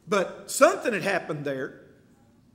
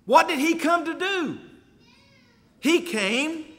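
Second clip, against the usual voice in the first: louder and higher, pressing each word home; fervent preaching; 7 semitones higher, 5 dB louder than usual. Real, not acted. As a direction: preach it hard to the congregation. Preaching at full force.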